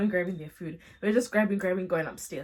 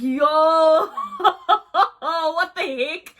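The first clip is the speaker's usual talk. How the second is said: high, loud laugh-cry through the words; laughing in disbelief at what he said; shocked laughing; 7 semitones higher, 8 dB louder, much slower than usual. Retold or imitated. Tolu laughing in shock at Leonard's confession, high and loud.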